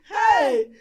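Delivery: high, very loud exclamation; joyful shout. Acted. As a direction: shout it out in delight. Joyful outcry after laughing.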